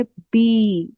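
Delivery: "बी" with a long drawn-out "ई"; long vowel demo. Shown as a demonstration. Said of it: "तो हम इसको खींच कर पढ़ेंगे". ("बी" को खींचकर लंबा बोलना)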